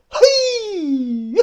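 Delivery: very high, loud whooping cry; imitated Bigfoot shout. Bigfoot mocking him from the trees, loud whoop. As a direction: yelp it out like a laughing Sasquatch. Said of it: "and then".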